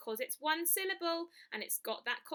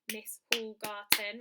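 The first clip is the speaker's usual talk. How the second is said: beat out syllables; 3 semitones lower, 3 dB louder, slower than usual. Shown as a demonstration. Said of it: "think about the syllables".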